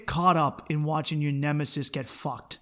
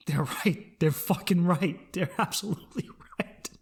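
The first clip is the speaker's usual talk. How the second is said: laughing breathily; 4 dB quieter than usual. Real, not acted. Laughing to himself.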